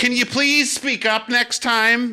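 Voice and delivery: very high, slow prissy voice; mock annoyed quote. Mocking people who blame Mitch McConnell, in a very high voice. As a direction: mimic a condescending questioner. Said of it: "as if it's their fault".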